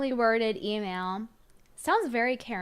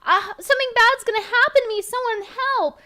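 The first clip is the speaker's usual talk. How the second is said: hammed-up panicked damsel, much higher and louder; mocking Pearl; 12 semitones higher, 9 dB louder than usual. Host mocks Pearl's fear of the night, shrieking mock panic. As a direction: squeal it like a fake-terrified Karen. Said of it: "when it's Pearl, it's like".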